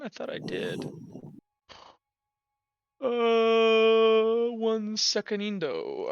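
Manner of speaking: ordinary talk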